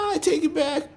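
very high, tearful pleading; panicked shriek. A narrator's reading. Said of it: "he shrieked".

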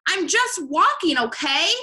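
irritated bird outburst; exasperated shout. A narrator's reading. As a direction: snap it high and loud, fed up. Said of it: "shouted Bird".

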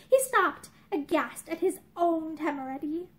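plain reading voice

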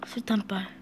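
수류탄빨 whispered low and soft; whispered mutter. Real, not acted. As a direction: mutter 수류탄빨 under breath. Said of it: "repeated 수류탄빨".

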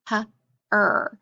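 H as a short puff, then a held "er"; sounded out. Shown as a demonstration. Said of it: "sound it out".

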